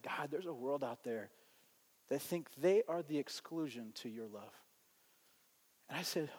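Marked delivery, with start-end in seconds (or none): 0.0-4.5 soft, low plea to God; hushed prayer. Retold or imitated. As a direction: confess quietly to God, retold. Retold anguished private prayer.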